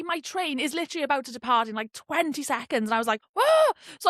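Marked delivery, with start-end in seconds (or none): 3.3-3.7 high, loud yelp of alarm; retold panic. Passenger seeing train about to leave, mock panicked cry.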